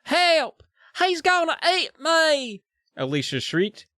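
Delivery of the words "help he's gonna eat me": put-on Southern girl shriek. Alicia screaming for help, now with a Southern accent. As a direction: cry for help with a Southern drawl. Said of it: "oh, she's Southern".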